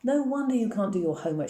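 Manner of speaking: an understated shout of exasperation. A narrator's reading. Mother scolding her daughter over homework, shouted. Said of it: "she shouted".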